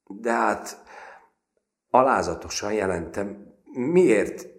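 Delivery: high, loud fighting-tears question; straining desperate plea. A narrator's reading. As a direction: force the words out near tears. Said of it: "erőlködve újra megszólalt".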